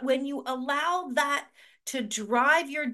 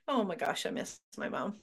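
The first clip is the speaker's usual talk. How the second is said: a soft, unvoiced, aching aside; missing mom; 4 semitones lower, 6 dB quieter, faster than usual. Real, not acted. Host suddenly missing her late mom, hushed and sad. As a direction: let the grief slip out under the breath.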